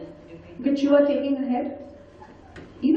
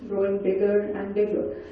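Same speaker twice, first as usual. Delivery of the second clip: ordinary talk, nothing marked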